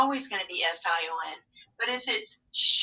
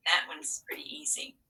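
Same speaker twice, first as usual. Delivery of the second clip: whispered aside; 5 semitones higher, 7 dB quieter than usual. Real, not acted.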